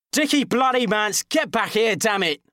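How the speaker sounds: high, loud angry call across the road; exasperated yell. Acted. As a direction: bellow his name in fury. Narrator yells at Dickie.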